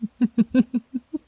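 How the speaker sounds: short teasing laugh; amused laugh. Acted. Alpha laughing at the startled young wolf, teasing chuckle.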